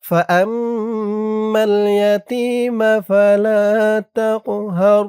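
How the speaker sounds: slow, melodic Quran recitation, stretched vowels; drawn-out recitation. Shown as a demonstration. Teacher reads the whole surah aloud for students to follow. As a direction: chant it slowly with long vowels. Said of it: "আমার সাথে বলুন".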